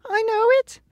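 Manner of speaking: a big coward admitting it; high squeaky lion in shame; ashamed lion. A narrator's reading. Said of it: "hanging his head in shame".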